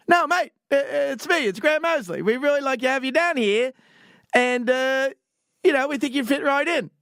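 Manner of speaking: high, hammed-up Aussie voice; insistent Australian recruiter on the phone; too-Australian accent. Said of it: "it sounded too much Australian".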